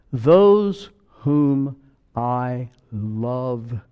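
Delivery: drawn-out, very slow reading; slow scripture reading. Acted. Preacher reading Revelation to the church, slow solemn reading. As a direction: linger on every word of the verse.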